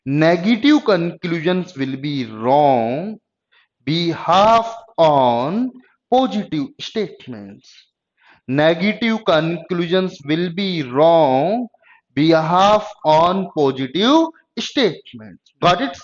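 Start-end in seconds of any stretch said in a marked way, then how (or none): 0.1-7.7 each word spaced out slowly for notes; slow, deliberate note-taking dictation. Shown as a demonstration. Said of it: "note down भी कर सकते हैं". 8.5-15.5 spaced-out words, paced for writing down; unhurried dictation for notes; slow dictation. Shown as a demonstration. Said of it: "note down भी कर सकते हैं".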